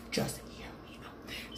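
breathy whispered plea. Real, not acted. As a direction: drop to a whisper to the viewers. Repeats plea, now whispered.

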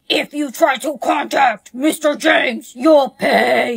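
hammed-up tough-guy warning; imitating a scary thug. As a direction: growl it like a mafia enforcer. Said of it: "some big scary guy came to my house saying".

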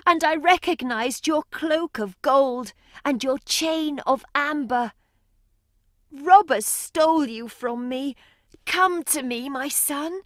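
high, tender pleading voice; pleading mother. Acted. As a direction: beg him gently to come. Mother pleads with her son.

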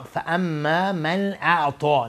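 اعطا with rounded mouth and dark vowel; heavy ta'a. Shown as a demonstration.

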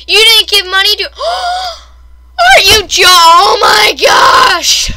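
high, loud shriek with a gasp; outraged shout. Real, not acted. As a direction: shout in disbelief, drawing out the end.

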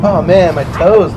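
normal talking voice

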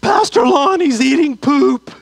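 lightly voiced horrified teen girl; alarmed girl. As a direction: shout it like a scandalised teen girl telling everyone. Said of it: "she ran to the bathroom and goes".

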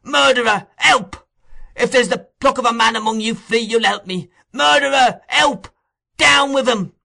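put-on very high, loud boy's shouts; frantic yells to raise the alarm; crying for help. A narrator's reading. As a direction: scream out accusations and pleas for help. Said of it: "Pouring out these cries".

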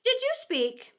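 high-pitched, pointedly polite question; polite inquiry. A narrator's reading. Mouse questioning the Lory who interrupted, pointedly polite. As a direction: ask with strained courtesy. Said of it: "But he said politely".

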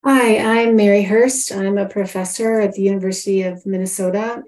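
ordinary talk

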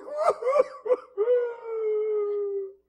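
loud, very high wail; hammed-up bawling, long and high; exaggerated sobbing. Preacher on the third replay, loud high mock wail. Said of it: "By the third time, I'm like".

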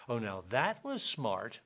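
drawn-out mock praise with swooping pitch; sarcastic. Shown as a demonstration. Speaker showing how sarcasm says the opposite, sarcastic. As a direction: say it slowly, meaning the opposite. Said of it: "sarcasm is built on opposites".

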